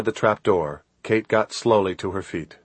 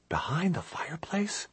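high, rising whispered question; 10 semitones higher, 12 dB quieter than usual. A narrator's reading. Kate questioning what Chris just told her, whispering. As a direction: whisper it back in surprise, pitch climbing. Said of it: "she whispered".

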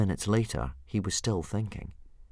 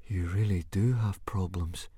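awed. Acted. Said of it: "he said in an awed voice".

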